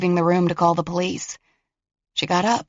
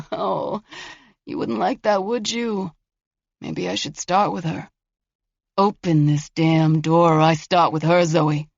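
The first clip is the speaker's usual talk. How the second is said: voice of a killer. Acted. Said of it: "The voice of a killer".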